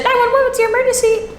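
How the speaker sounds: very high, sing-song dispatcher line; mistaken caller voice. Retold or imitated. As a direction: imitate a phone operator way up high. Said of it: "Oh, that's my voice, sorry".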